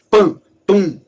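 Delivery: pam, tum gritado, agudo e forte; onomatopeia imitada. Shown as a demonstration. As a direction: solte os sons de gibi. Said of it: "Mas você tem plaf". (imitando sons de gibi com força)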